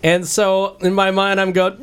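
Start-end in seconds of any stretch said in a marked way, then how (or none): none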